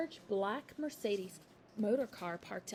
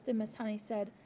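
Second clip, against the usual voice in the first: usual speaking voice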